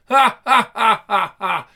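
loud staccato "ha ha", falling in pitch; hammed-up cackling; acted cackle. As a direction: burst into a villain's laugh. Villain's written laugh voiced.

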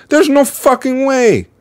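high-pitched, incredulous outburst; emphatic disbelief. Real, not acted. Host reacting to the woman's phone call, incredulous. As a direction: pitch it high, utterly unconvinced.

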